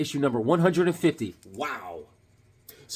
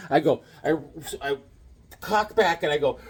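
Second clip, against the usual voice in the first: ordinary delivery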